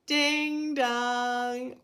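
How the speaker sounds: ding dong like a doorbell; playful doorbell sound; doorbell chime. A narrator's reading. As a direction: ring it out like a bell. Doorbell rings in story.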